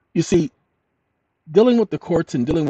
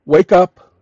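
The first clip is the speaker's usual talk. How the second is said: commanding call; 5 semitones higher, 9 dB louder than usual. Shown as a demonstration. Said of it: "Now, watch this".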